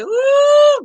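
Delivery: high, loud cheering whoop. Real, not acted. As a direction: whoop it up for the reggae artist. Cheering artist on the live.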